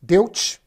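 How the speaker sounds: Deutsch said as "deutch", rising; German word. Shown as a demonstration. Teacher tests the German word for students, tentatively. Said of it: "qual seria a pronúncia".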